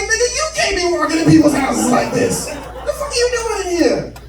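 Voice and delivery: high, pushed disbelief at the intruder; incredulous confrontation. Retold or imitated. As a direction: act out squaring up to the stranger.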